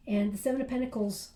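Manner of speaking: ordinary talk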